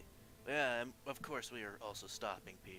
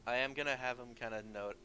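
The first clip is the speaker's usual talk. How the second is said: ordinary delivery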